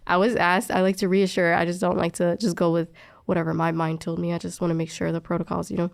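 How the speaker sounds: ordinary talk, nothing marked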